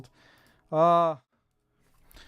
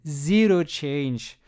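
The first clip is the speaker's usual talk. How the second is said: "Zero change" drawn out very slowly, each word leaned on; slow emphatic; 3 semitones lower, much slower than usual. Real, not acted.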